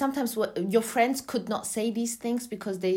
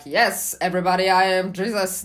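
a hint of grand announcer, loud; mock Jesus proclamation; 6 dB louder than usual. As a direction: proclaim it loudly, tongue in cheek. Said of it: "I was not like".

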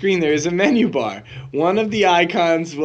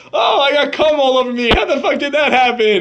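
high-pitched, hammed-up shock; mock-horrified wail, much higher than usual; mock bewildered outcry; 10 semitones higher, 4 dB louder than usual. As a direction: play a baffled victim crying out in dismay. Acting out baffled stop-porn victims.

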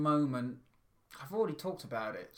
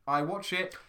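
usual speaking voice

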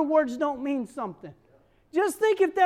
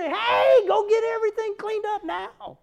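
put-on snap at kids; 7 semitones higher, 4 dB louder than usual. Mother snaps back at kids.

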